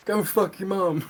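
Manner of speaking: high-pitched imitation of a trash-talking kid; put-on high kiddie gamer voice; mock twelve-year-old. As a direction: throw an insult like a kid on voice chat. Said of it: "twelve-year-olds".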